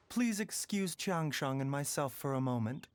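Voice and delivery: ordinary delivery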